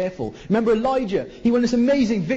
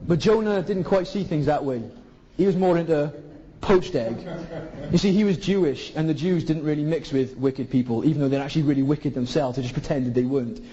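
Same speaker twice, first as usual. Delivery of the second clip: ordinary talk, nothing marked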